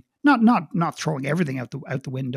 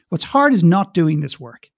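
ordinary delivery

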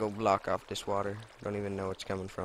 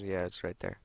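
ordinary delivery

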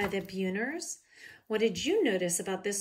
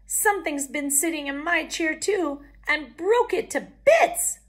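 little bear cub; 8 semitones higher, 9 dB louder than usual. A narrator's reading. Cub voice, upset.

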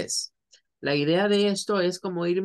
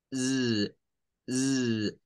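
buzzing z; 4 semitones lower than usual. Shown as a demonstration. Teacher shows students the z sound. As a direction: hum a voiced z. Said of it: "con un sonido z".

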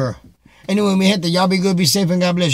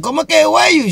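high, loud disbelieving question; incredulous surprise; 9 semitones higher, 6 dB louder, faster than usual. Retold or imitated. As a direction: disbelief shooting up in pitch. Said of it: "I'm like".

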